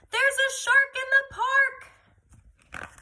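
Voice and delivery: put-on high, loud boy's cry, slowly drawn out; loudest voice. A narrator's reading. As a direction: cry it out like an alarmed little boy. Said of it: "In his loudest voice, Timothy cries".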